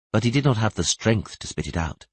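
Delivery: ordinary delivery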